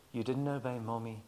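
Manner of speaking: soft, low, gentle correction; gentle quiet mother. Retold or imitated. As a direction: kneel and speak softly to a child. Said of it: "goes down to their level".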